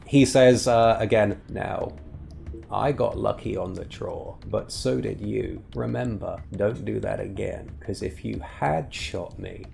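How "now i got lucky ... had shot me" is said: softer, hushed gunslinger warning; game master voicing a rider's quiet threat. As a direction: warn him low and steady, like a lawman. GM voices Carlisle warning.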